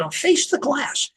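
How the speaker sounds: high-pitched teacher's command; stern teacher. Retold or imitated. Teacher orders boy at front of class, retold command. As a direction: imitate a teacher ordering a pupil.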